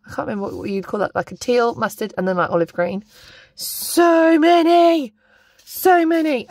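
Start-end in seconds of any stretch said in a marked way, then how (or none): 3.5-5.1 high, slow, louder gush; drawn-out delight. Real, not acted. Unboxing washi, gushing over the colours, slow and delighted. 5.6-6.5 quicker delight. Real, not acted. Repeated exclamation.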